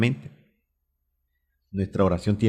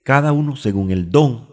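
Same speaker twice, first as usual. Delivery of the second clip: proclama el versículo más alto y más fuerte; lectura proclamada; 5 semitones higher, 6 dB louder than usual. Real, not acted. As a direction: lee la cita bíblica a voz en cuello. Predicador lee el versículo con fuerza. (proclama el versículo en voz alta)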